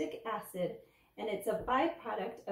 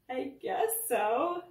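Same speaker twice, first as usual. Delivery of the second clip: slow, higher, louder drawn-out reply; hesitant; 4 semitones higher, 6 dB louder, much slower than usual. A narrator's reading. Danny answering the dentist about germs, hesitant. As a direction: drag it out, unsure of the answer. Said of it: "Danny hesitated".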